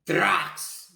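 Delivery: sudden, high-pitched blurted shout; one explosive, insanely loud word. Retold or imitated. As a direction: shout it out at full power. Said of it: "Really loud, insanely loud".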